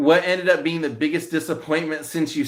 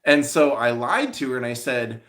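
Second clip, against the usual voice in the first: normal talking voice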